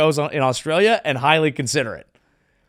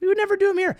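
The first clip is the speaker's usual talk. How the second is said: high-pitched mock echo; high mocking repeat; much faster than usual. Shown as a demonstration. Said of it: "second take, much higher".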